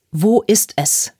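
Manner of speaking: slow, forceful demand; angry bellow. A narrator's reading. Lucas demanding the phone from his daughter, angry bellow. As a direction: bellow it slowly, word by word. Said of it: "brüllte Lucas sie an".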